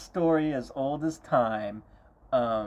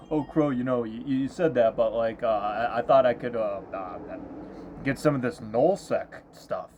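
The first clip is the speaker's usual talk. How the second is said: fumbling excuse; 4 dB louder than usual. Retold or imitated.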